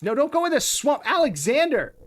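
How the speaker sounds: high, loud yell of protest; alarmed shout. Real, not acted. Gamer yelling at the hero entering a swamp, alarmed shout. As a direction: shout to stop him, pitch way up.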